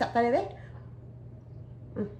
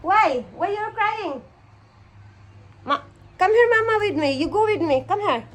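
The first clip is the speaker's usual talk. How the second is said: calling out; 8 semitones higher, 6 dB louder than usual. Real, not acted. Mother calling her crying child to come here.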